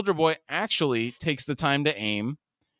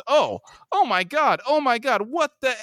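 high-pitched panicked exclamation; freaking out; 12 semitones higher than usual. A narrator's reading. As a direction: act out a shocked freak-out, voice shooting up. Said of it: "Huey freaks out and says".

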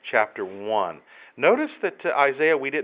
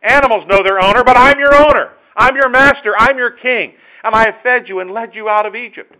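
loud, high indignant rebuke; God rebuking; 10 semitones higher, 14 dB louder than usual. Pretend. Preacher voicing God reproaching ungrateful people, loud indignant rebuke. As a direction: thunder at them, hurt and angry. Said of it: "That's what he's saying".

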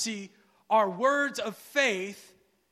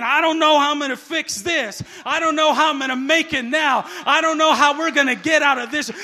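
loud, rushed mock helplessness; mock despairing person; 5 semitones higher, 12 dB louder, faster than usual. Preacher imitating what God never says, loud mock despair. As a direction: rattle off worries in exasperated parody. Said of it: "like a depressed individual".

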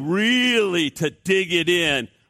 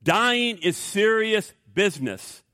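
ordinary talk

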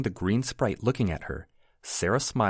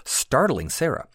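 plain reading voice

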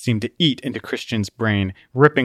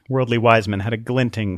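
ordinary delivery

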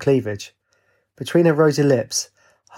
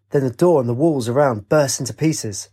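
ordinary delivery

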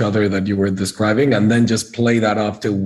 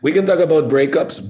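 normal talking voice

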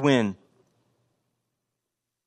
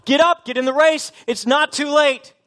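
high, loud urgent plea; Paul pleading; 10 semitones higher, 5 dB louder than usual. Retold or imitated. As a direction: urge lagging runners to get back in the race. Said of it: "and he's saying".

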